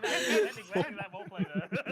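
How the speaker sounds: slow, loud words through laughter; laughing. Real, not acted. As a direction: talk while cracking up. Laughing while speaking.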